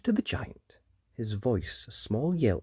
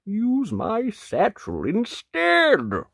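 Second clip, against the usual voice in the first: wolf voice; 9 semitones higher, 3 dB louder, slower than usual. Acted. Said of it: "said to the knight".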